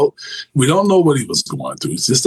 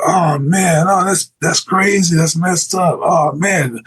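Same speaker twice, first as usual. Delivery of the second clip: higher-pitched, hammed-up crowd reaction; mock inmates screaming; 6 semitones higher than usual. Officer imitating inmates reacting to a man's jump, mock screaming. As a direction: imitate onlookers crying out, pitched up but not truly yelling. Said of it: "everybody screaming".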